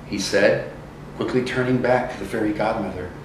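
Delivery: usual speaking voice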